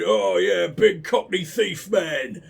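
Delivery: loud, low cockney rumble, drawn out; big cockney tough-guy impression; gruff cockney thief. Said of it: "he was, like, a big, gruff fucking".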